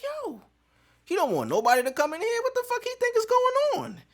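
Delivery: high, fast disbelieving mutter; incredulous inner voice. Retold or imitated. Recalling his thoughts in the stall, high incredulous whine. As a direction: squeak out your confusion. Inner reaction quote, raised.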